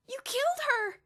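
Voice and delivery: high piercing shriek. A narrator's reading. Elmer shrieking at Bruno for killing his ant, very high and loud. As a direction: scream it in horror. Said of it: "Elmer shrieked".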